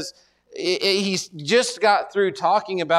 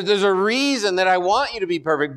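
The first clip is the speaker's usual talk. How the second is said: normal talking voice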